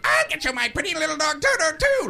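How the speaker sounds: very high witch shriek, climbing at the end; wicked witch. Acted. Witch on a vacuum cleaner threatening the traveller, high shriek. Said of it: "there's a little wicked witch".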